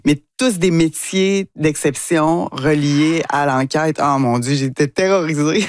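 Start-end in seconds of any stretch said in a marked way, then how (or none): none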